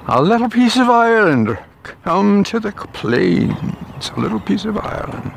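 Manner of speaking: put-on a deliberately terrible Irish accent for a laugh; bad Irish accent. Vlogger jokes the green ditch is Ireland, in a bad Irish accent. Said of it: "Terrible accent".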